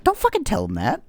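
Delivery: high, loud mock mom scolding. Mom scolding dad at dinner table, high and loud. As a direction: squawk at the husband like an outraged mom.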